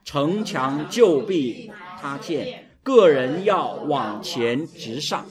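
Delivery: loud, slow, measured scripture reading; leading unison reading. Real, not acted. Preacher leading the congregation through Joshua, loud slow recitation. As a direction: lead the crowd word by word, drawn out and forceful.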